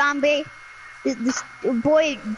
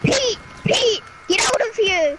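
playful angry yell; 6 semitones higher than usual. Pretend. Kicking Pete out for hating Harambe, mock angry yell. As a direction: shout him away in mock fury. Chasing someone off angrily.

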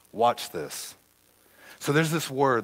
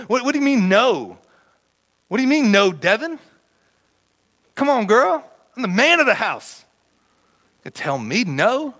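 higher, louder indignant protest at being told no; indignant complaining; 7 semitones higher, 4 dB louder than usual. Retold or imitated. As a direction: huff like a man told no at home. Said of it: "I start complaining".

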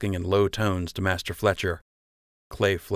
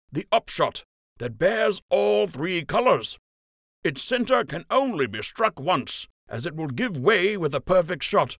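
Captain Fry announcing; 12 semitones higher than usual. A narrator's reading. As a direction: lay down the rules. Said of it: "Captain Fry said".

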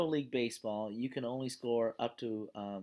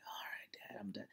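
whispered, much softer give-up; a beaten kid murmuring to his dad in a whisper; defeated son; 4 semitones lower, 15 dB quieter than usual. Retold or imitated. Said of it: "the son's like".

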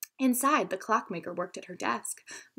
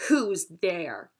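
angry giant; 3 semitones lower than usual. A narrator's reading. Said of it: "called out angrily".

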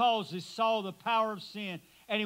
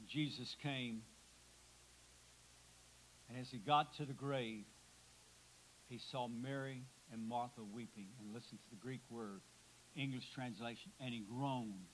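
hushed; 9 semitones lower, 14 dB quieter than usual. A narrator's reading. Preacher to mourners at the funeral, hushed and much softer. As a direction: drop to a near-hush, much softer and lower. Hushed retelling at Lazarus' grave.

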